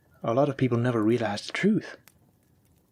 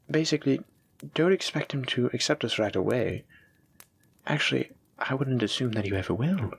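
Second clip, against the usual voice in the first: normal talking voice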